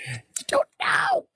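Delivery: breathy whispered insistence; whispering. Acted. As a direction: hush it out, slow and secret. Whispered after repeated protest.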